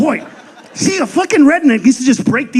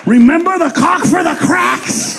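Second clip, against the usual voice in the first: excited callback; 7 semitones higher, slower than usual. Acted. Callback shouted to crowd.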